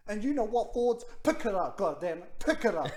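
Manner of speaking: loud, frustrated outburst at the team; heated sports-fan rant; venting. Real, not acted. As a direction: let it rip, punching each short command. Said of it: "I needed a vent".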